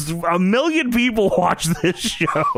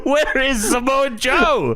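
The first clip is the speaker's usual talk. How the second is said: laughing; 5 semitones higher, much slower than usual. Retold or imitated. Mock indignant question.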